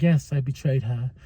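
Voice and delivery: mock cold dismissal building to a command; lightly voiced villain sending her off; Mariah. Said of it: "she's like".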